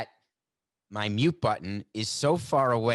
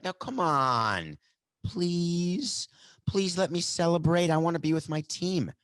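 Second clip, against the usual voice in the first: high, drawn-out pleading; begging; 6 semitones higher, slower than usual. Acted. Player begging security to let him celebrate, pleading. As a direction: plead with a stretched, whiny please. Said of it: "That's like begging".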